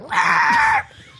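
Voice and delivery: loud, high squawking cluck like a chicken. Pretend. Doing a chicken noise for the podcast hosts, loud squawk. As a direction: squawk it out like a hen. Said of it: "really great chicken sound".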